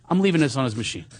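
ordinary delivery

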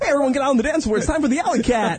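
cheesy wedding DJ. Pretend. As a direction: sell the dance floor like a cheesy DJ.

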